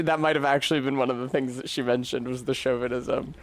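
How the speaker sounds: normal talking voice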